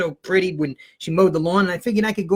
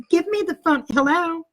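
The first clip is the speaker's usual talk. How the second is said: high put-on mother; fake mom voice; 10 semitones higher than usual. Teen plays his mother answering the teacher, high-pitched voice. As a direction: impersonate Mom taking the phone. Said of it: "I got the voice down pat".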